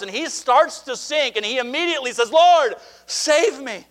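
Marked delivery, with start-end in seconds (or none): 2.3-3.9 high, drawn-out plea; urgent cry for help. Acted. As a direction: call out for rescue, slowly and high. Peter calls out as he sinks.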